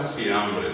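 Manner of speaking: "siambre" drawn out, S in place of F; slow "siambre" with a Guaraní speaker's S for F. Shown as a demonstration. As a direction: say "siambre" with an S where the F belongs. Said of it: "no les va a decir".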